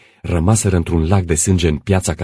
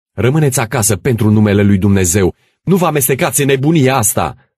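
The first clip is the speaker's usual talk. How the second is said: loud, rising urgent appeal; shouted plea; 7 semitones higher, 7 dB louder than usual. Acted. The others to Costache and Tudorița, pleading shout. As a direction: call after them to stop. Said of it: "le strigară ceilalți".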